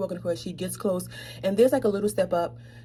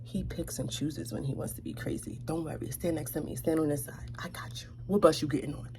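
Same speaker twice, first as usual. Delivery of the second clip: soft unvoiced reassuring whisper; whisper back; 5 semitones lower, 9 dB quieter than usual. Whispering back to the scared lady at the stop. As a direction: whisper it back to calm her. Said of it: "So I whisper back".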